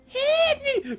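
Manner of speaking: put-on very high little-girl shriek; screaming for help. Little Red trapped in the sack, high squeal. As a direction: squeal it in falsetto.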